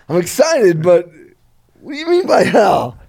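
half-laughing alarm at the word hell; excited disbelief. Retold or imitated. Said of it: "I'm like".

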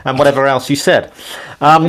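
ordinary talk

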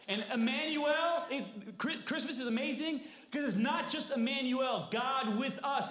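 loud, high-pitched preacher's cry; preaching at full force. Real, not acted. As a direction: proclaim it to the whole congregation.

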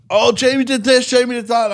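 high, loud tattling whine; whiny little brother. Retold or imitated. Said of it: "So my brother was like".

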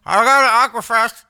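high, loud voice through a mouthful; toothpaste in mouth. Retold or imitated. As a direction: call out teasingly with a mouth full of toothpaste. Repeats the Raven toothpaste bit.

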